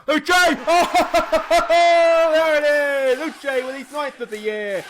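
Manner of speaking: high, roaring celebration with drawn-out shouts; long, loud commentator's goal cry, easing at the end; ecstatic goal call. Real, not acted. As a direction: scream the goal, then laugh with joy. Live goal in football game.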